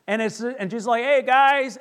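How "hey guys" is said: high, loud friendly hail; calling out. Acted. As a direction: call across the water to friends. Jesus calling to the boat.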